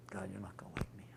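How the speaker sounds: a quick whisper under the breath; whispered aside. Real, not acted. Teacher whispers an aside to the class. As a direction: let it slip out in a whisper as a confession.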